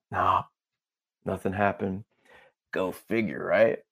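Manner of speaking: ordinary talk, nothing marked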